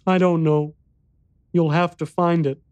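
put-on high-pitched child voice; young boy. A narrator's reading. Boy unable to show the exit, child voice. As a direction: answer as an earnest little boy.

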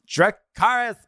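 high, put-on catchphrase call; Stassi Schroeder. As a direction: mimic her show greeting in a high voice. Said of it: "This is Stassi Schroeder".

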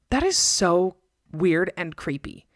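slow, unsettled disgust; creeped out. Real, not acted. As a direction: let the creepiness sink in. Disturbed reaction, slow.

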